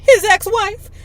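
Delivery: high, loud incredulous query; shocked question. A narrator's reading. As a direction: pitch it up in disbelief. Shocked reply in read dialogue.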